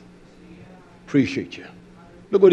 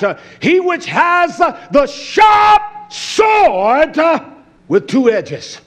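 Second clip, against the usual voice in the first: raised-pitch sermon delivery, key words stretched; forceful preaching; 8 semitones higher, 4 dB louder than usual. Real, not acted. Preacher revealing who has the sharp sword, forceful proclamation. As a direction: build to a drawn-out shout on the sword.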